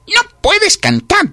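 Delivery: very high, loud, drawn-out ban; outraged ant stretching every word; stern refusal. A narrator's reading. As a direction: forbid it with exasperation. Ant forbids singing.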